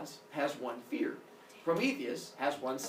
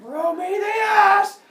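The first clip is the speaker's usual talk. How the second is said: much higher, louder, drawn-out angry bellow; furious summons; 12 dB louder, much slower than usual. Acted. Zeus bellows for Prometheus to come answer for the fire. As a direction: roar the name stretched long.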